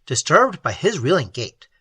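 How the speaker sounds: ordinary delivery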